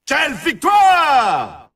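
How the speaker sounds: high, excited announcer hailing a champion; triomphe exalté. Acted. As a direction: shout out the victory, stretching the final words. Announcer hails the champion's victory. (voix de commentateur exalté, très aiguë)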